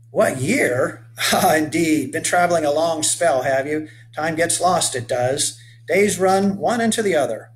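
rugged 1830s settler hailing a stranger; weathered old boatman. Acted. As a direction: call out to the boy like a sun-burned old sailor. Amused settler answers the boy.

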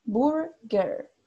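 burger slowly, both R sounds clearly rolled into the vowel; slow rhotic burger, American English. Shown as a demonstration. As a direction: burger the US way, the R marked at the end. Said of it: "Ahí marcan la R".

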